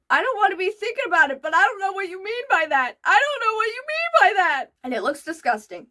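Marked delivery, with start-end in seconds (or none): 0.1-4.6 high, fast grossed-out protest; disgusted disbelief. Real, not acted. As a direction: recoil from the image. Disgusted reaction to drizzle.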